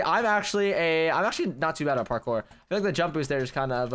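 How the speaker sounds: ordinary talk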